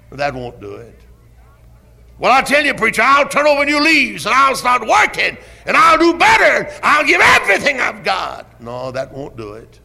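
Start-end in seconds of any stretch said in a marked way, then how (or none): 2.2-8.6 much higher, louder frantic plea ending in a shout; desperate bargaining. Retold or imitated. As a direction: beg the preacher with everything. Sinner voiced bargaining with preacher.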